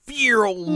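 high, loud playful vroom, rising; mock engine vroom. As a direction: zoom off with a squeaky engine noise. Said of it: "And then you see Yo go".

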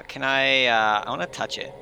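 normal talking voice

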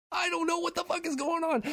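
very high-pitched imitation of a bewildered pupil; baffled outburst. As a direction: squeak it out in total bafflement. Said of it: "never seen him emote like that".

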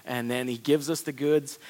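usual speaking voice

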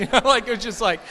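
high, loud laughing aside; bursting into laughter while telling it. Real, not acted. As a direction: laugh through the words at yourself. Laughing at his own crying.

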